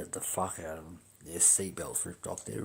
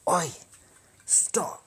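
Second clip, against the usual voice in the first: sharp, louder police shout; imitated yell; 12 semitones higher, 8 dB louder than usual. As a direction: yell a command like a cop stopping someone. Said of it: "they're yelling at".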